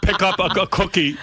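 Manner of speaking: hammed-up stubborn dad; imitating father. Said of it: "father's rule".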